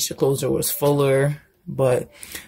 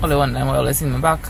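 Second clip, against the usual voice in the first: ordinary talk, nothing marked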